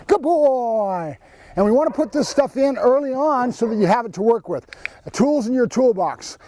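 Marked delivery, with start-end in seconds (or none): 0.1-1.2 put-on "Good boy" with "boy" stretched long and falling; explosive release praise. Shown as a demonstration. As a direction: burst into praise to release the dog. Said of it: "we got our explosion release".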